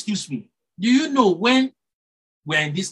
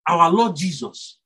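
ordinary talk

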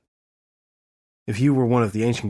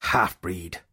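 coldly; 3 semitones lower than usual. A narrator's reading. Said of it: "the fairy said coldly".

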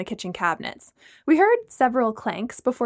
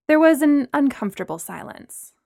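ordinary delivery, nothing marked

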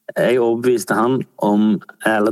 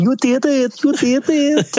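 high, ecstatic German fan cheer; excited cheering. Retold or imitated. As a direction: cheer it like a thrilled football fan. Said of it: "var sånn".

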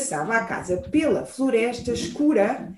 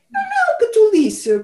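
high, hooting dismissal; owl voice; 8 semitones higher, 3 dB louder than usual. Acted. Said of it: "corujou a coruja".

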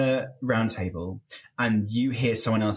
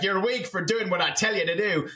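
put-on higher-pitched, not-great Irish accent; bad Irish accent; 7 semitones higher than usual. Mocking a player blaming those who obeyed him, bad Irish accent. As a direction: do a clumsy Irish impersonation of him. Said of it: "That's not a great accent".

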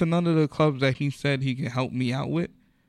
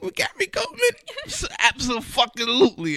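much higher, giddy disbelief breaking into a laugh; overjoyed falsetto yes; thrilled squeal; 12 semitones higher, 4 dB louder than usual. Retold or imitated. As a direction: squeak it out like a starstruck fan. Replaying his thrilled reaction.

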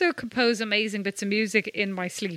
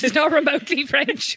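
higher, breaking into laughter; laughing; 5 semitones higher, 3 dB louder than usual. Real, not acted. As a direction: laugh through the words. Laughs at her own claim.

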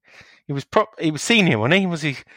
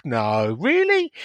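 mock disbelief; much slower than usual. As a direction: play the astonished grandchild. Grandkid reacting in disbelief.

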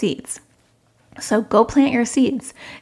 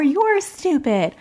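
put-on higher, slow, sulky child insult; defiant child; 8 semitones higher, much slower than usual. Child insulting parent at bedtime, slow and sulky. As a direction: drag it out like a pouting kid. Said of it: "bark back".

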